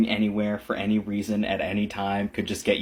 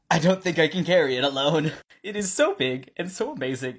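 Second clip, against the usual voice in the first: overwhelmed joy; 5 semitones higher than usual. Real, not acted. Emotional overflow, laughing.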